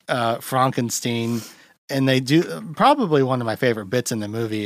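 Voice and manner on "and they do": usual speaking voice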